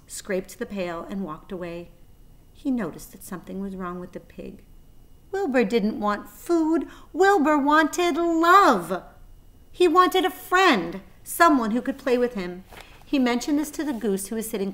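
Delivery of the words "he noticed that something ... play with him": ordinary delivery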